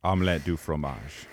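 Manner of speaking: "Omelette du fromage" in a hammed-up French accent; mock French, low and lazy. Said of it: "Say one French sentence".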